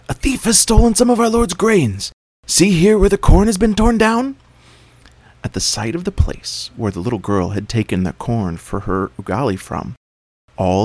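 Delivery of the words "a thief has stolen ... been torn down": high, loud agitated animal voice; agitated servant. A narrator's reading. Servant showing the torn corn, high and agitated. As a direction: point out the torn corn, alarmed.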